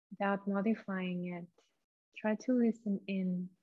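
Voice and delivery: soft, hushed meditation voice with long pauses; guided meditation. Acted. Leads a guided breathing meditation.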